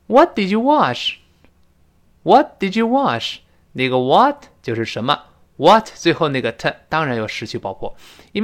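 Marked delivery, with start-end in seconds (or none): none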